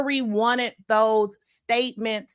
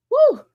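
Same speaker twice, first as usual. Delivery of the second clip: short high whoop; quick whoop on settling in; winded whoop; 14 semitones higher, 8 dB louder than usual. Real, not acted. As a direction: let out a loud, high "woo". Arrives back at her chair.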